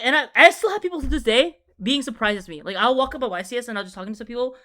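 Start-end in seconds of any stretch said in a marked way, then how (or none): none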